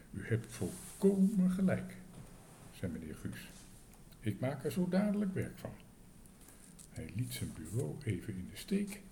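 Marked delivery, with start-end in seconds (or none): 0.1-5.7 eager hotel manager. A narrator's reading. Manager agrees eagerly with guest.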